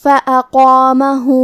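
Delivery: فَأَقَامَهُ with a heavy qaf and alif and the final هُ stretched for two beats; heavy qaf and short madd. Shown as a demonstration. Tajweed teacher reading فَأَقَامَهُ aloud as an example. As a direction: draw out the hu of فَأَقَامَهُ. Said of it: "ہم اپنی آواز دو مد کے برابر لمبی کریں گے".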